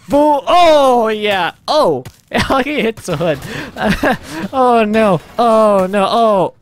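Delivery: real thrilled reaction; excited outburst. Real, not acted. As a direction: whoop at the impact, then delighted dismay. Reacting to another big hit.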